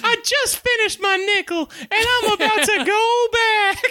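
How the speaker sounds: high, slow squeaky elf voice; tiny bug bragging, drawn out; little elf voice. Retold or imitated. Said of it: "a little elf voice".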